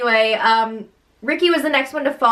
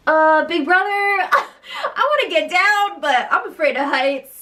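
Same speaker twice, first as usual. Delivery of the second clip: mock frightened whine; 7 semitones higher than usual. Contestant begging Big Brother to get her down, mock whining. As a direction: whine it like a comically scared contestant. Imitating scared contestant wanting down.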